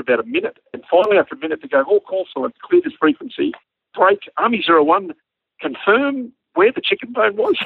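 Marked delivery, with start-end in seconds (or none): 1.8-7.6 mock radio controller. Brisbane asking the pilot after everyone laughed, in mock official tones. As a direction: imitate a controller struggling to restore radio order.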